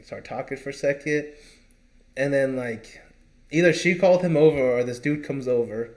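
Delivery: ordinary talk, nothing marked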